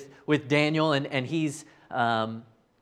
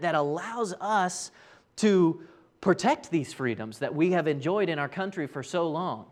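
ordinary talk, nothing marked

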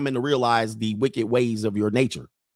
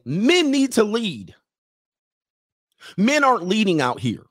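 mock woman's voice; 7 semitones higher, 3 dB louder than usual. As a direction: mimic women demanding men lead. Mocking women's demand.

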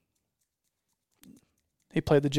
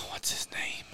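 barely voiced, searching whisper; under the breath, much softer; whispered to self; 12 dB quieter than usual. Real, not acted. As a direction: whisper it to yourself while trying to remember. Trying to recall an actor's name.

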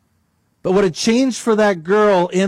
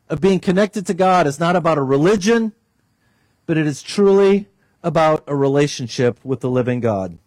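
usual speaking voice

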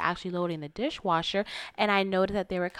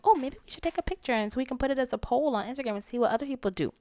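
higher, quick, harmless-sounding suggestion; non-aggressive suggestion; 6 semitones higher, faster than usual. Retold or imitated. Woman re-enacting her social media idea, innocently suggesting. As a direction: pitch it up and keep it breezily innocent. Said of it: "That's not aggressive. That was a suggestion".

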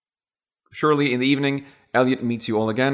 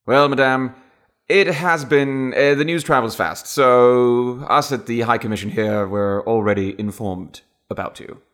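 put-on upper-class British official, higher at first; high-class British accent; 2 semitones higher, 4 dB louder than usual. High Commission man answering a new arrival, high-class British accent. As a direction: address a lady in hammed-up Received Pronunciation. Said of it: "high-class British accent".

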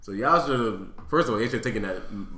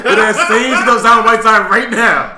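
Pat Riley imagined cheering it on, much higher and louder; big mock-excited yell; hammed-up gleeful shouting; 8 semitones higher, 13 dB louder than usual. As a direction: holler it as a delighted boss, drawing words out.

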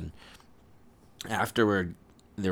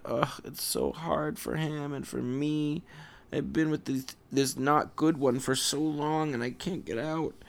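crying complaint; 7 semitones higher than usual. A narrator's reading. Princess cries over being stuck so long, acted high-voiced distress. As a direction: wail the complaint without real sobs. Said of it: "she started crying and crying and crying".

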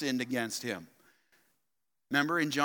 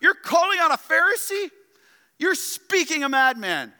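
outraged crowd; 10 semitones higher, 6 dB louder than usual. Acted.

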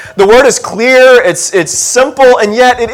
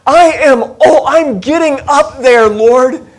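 put-on higher, slow, wondering old man's voice; old Abram; 6 semitones higher than usual. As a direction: play Abram marvelling at his old age. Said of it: "he is thinking to himself".

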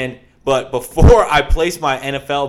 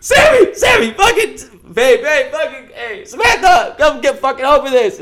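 much higher and louder, frantic calling with nervous laughs; panicked yelling; 11 semitones higher, 7 dB louder than usual. Retold or imitated. Stuck in the dark elevator, calling his girlfriend: frantic shouts. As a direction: shout her name like you're trapped and scared. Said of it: "Now I'm really freaking out".

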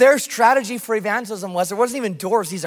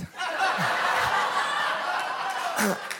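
soft breathy laugh; 5 semitones higher, 7 dB quieter than usual. Real, not acted. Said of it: "You're not allowed to say that".

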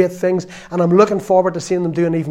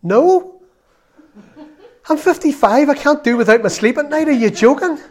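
high, louder, laughing protest; mock indignant refusal; 9 semitones higher, 5 dB louder than usual. Preacher retelling refusing a youth weekend, mock outraged. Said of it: "And I said".

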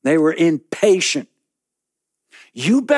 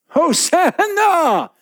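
high, drawn-out desperate cry for help; 4 dB louder, much slower than usual. Acted. Man trapped in a pit crying out for rescue, desperate high cry. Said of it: "that's a pretty desperate cry there".

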